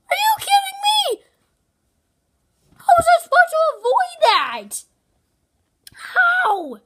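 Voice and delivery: high, loud outraged yell; frustrated shout. Real, not acted. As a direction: shout at the game in disbelief. Killed by an unfair laser.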